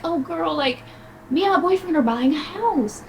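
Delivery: retelling a friend's house news, high and gushy; high mock girl-talk. Acting a friend's excited news.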